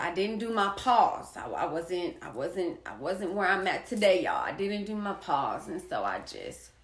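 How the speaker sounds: ordinary delivery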